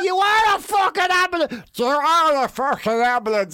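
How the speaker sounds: strained shout, high then dropping; outraged winded yell. Retold or imitated. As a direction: yell indignantly with the wind knocked out of you. Winded fighter rages at Red Cross man.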